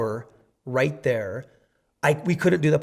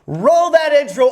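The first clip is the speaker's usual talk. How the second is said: loud, high call to roll the intro; 9 semitones higher, 7 dB louder than usual. Real, not acted. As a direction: call out the cue. Calling for the intro.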